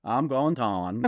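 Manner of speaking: slow, "Dawn" with an open aw vowel; "Dawn" sounding like dawn; Pennsylvania Dutch down. Retold or imitated. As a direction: drawl "I'm going Dawn". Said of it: "that's how Pennsylvania Dutch people say".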